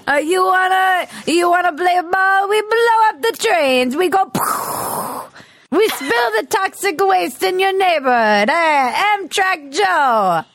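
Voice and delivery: high, hammed-up Italian mafia threat; Italian mobster impression. Someone in the mob threatening Amtrak Joe, bad Italian accent. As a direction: taunt in a badly faked Italian accent. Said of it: "This is my impression of someone in the mob".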